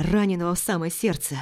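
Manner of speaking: usual speaking voice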